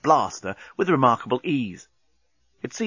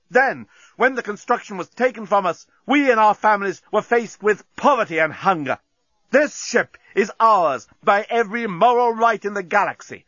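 high, impassioned declamation; ringing defiant speech; 8 semitones higher than usual. A narrator's reading. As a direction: proclaim your grievance to the enemy fleet. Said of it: "his voice rang round".

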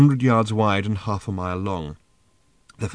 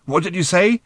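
raised, high-pitched shout to a companion; shouted question; 8 semitones higher, 4 dB louder than usual. A narrator's reading. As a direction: call out over a din, pitched high. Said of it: "shouted Arthur".

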